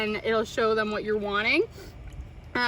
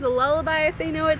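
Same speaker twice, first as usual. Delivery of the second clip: ordinary delivery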